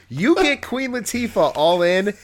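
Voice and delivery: usual speaking voice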